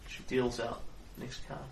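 ordinary talk, nothing marked

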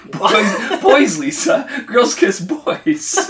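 high, loud, slow sing-song scolding; hammed-up scolding parent. Mocking a preachy lecture.

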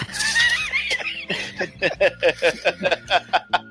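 high squealing burst of laughter; shrieking laugh. Real, not acted. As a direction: let out a shrill laughing shriek. Loud laugh during podcast chat.

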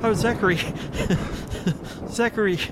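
high, laughing recognition; delighted laugh. Real, not acted. Visitor laughing at Zachary, high and delighted. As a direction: laugh and gasp the name.

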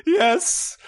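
high, laughing dopey duck voice; slack jawed dopey voice. Acted. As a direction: dim slack-jawed creature, pitch way up. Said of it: "the one with the dopey voice".

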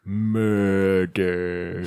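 Timothy Oliphant murder. Retold or imitated. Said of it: "I gotta say it like Timothy Oliphant".

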